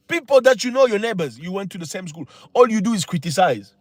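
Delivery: ordinary delivery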